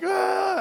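high mock crying wail; hammed-up sobbing cry. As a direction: imitate a roomful of mourners wailing. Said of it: "everybody's crying. They're like".

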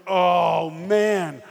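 loud oh man; dreading the dinner call, he groans it out loud. Retold or imitated.

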